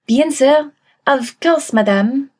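put-on obliging reply in a French accent. A narrator's reading. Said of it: "That French accent was so adorable".

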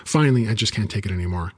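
ordinary delivery

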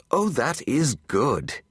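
delighted approval, lingering on the words. A narrator's reading. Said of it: "he delights".

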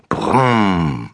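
falling car-engine brumm; child making a car noise; toy car brumm. A narrator's reading. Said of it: "macht er dabei".

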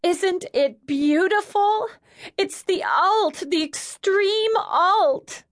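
very high, drawn-out rapture; gushing squeal. A narrator's reading. Mavis tearful over the newborn, gushing squeal. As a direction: gush it slowly in a high squeal.

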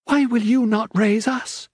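put-on high, earnest young girl's voice; pleading daughter Faith. Faith pleads with her father.

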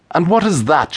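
put-on Laura pressing the young man, impatient. A narrator's reading. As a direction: demand it, out of patience. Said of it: "she asked impatiently".

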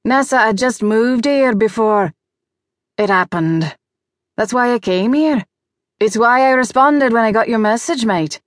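Australian man explaining himself insistently; Vance, earnest father with Australian accent. A narrator's reading. Vance explains why he came to help, earnest Australian accent.

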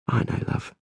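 soft whisper of comfort. Acted. Isabel whispers reassurance to her husband Tom. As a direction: breathe it to him gently.

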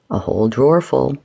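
low growl. A narrator's reading. John answering his partner about supplies, growling. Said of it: "he growled".

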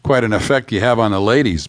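ordinary delivery, nothing marked

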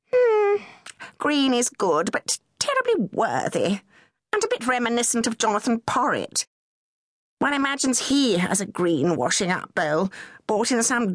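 a hint of an airy, teasing lady; mocking a worthy green bowl; Camilla, amused. A narrator's reading. Camilla teasing Charles about green, lightly voiced.

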